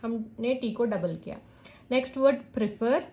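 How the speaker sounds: ordinary talk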